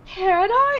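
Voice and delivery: high, timid ask; shy hopeful. Retold or imitated. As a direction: ask shyly for a hug. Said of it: "they're like".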